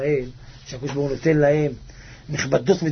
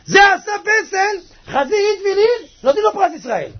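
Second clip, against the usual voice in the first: outraged outburst; 14 semitones higher, 5 dB louder than usual. Real, not acted. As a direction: draw the words out in high-pitched outrage. Outraged about the sculpture prize.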